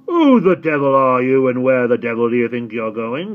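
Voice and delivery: loud growling gatekeeper; loud rough growl; growled challenge. A narrator's reading. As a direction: bark at the stranger from the dark. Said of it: "growled a voice ahead of him".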